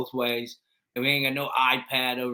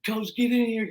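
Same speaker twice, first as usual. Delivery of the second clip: calling out; 11 semitones higher, 4 dB louder than usual. Retold or imitated. Kids calling the coach.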